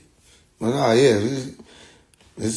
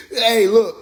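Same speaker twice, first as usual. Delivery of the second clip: normal talking voice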